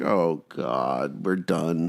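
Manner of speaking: low, deflated acted groan of defeat; mock resignation; acted dismay. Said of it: "And it's just like".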